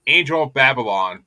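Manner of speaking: ordinary delivery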